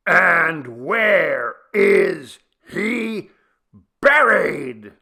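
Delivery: very loud, slow, drawn-out bellow. A narrator's reading. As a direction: bellow it at the deaf granny. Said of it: "bellowed Chebo again".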